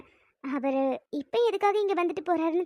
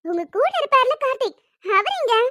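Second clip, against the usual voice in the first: insistent asking; 5 semitones higher than usual. Acted. Girl pressing her sister about where Karthik is, insistent. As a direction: demand an answer eagerly.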